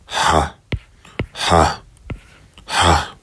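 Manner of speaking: h sound of the hey; breathy h drill. Shown as a demonstration. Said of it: "English H, like house".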